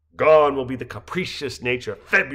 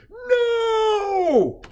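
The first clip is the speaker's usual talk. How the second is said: shouted no. Acted. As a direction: cry out in protest. Crowd denies being blessed.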